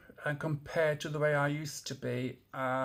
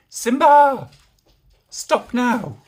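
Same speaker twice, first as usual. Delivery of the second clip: much higher, much louder yell; shouting; 11 semitones higher, 16 dB louder, much slower than usual. Real, not acted. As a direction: bark out a stop order. Shouting at the dog.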